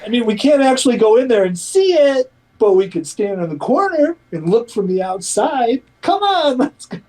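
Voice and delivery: high-pitched wheedling of the kids, hammed-up; mock eager parent. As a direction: sell a dull outing with fake excitement. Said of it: "imagine a family in Montreal going".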